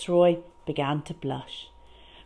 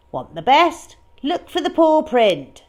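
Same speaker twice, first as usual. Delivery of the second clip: louder, higher jingle-like pitch; advert slogan; 8 semitones higher, 7 dB louder than usual. Acted. The biscuit label read as a bright advert. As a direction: sell it like a cheery ad. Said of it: "said the label".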